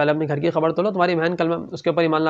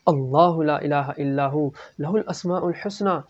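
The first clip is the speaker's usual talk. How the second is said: slow, soft melodic recitation; Quran recitation; 2 semitones lower, 4 dB quieter, slower than usual. Acted. Sister reciting Surah Taha to Umar, soft slow recitation. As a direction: recite the verses gently, drawing out the long vowels.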